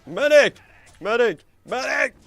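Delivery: loud urgent shout for a medic; calling for medic. Real, not acted. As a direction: yell for help across the battlefield. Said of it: "I can call for medic".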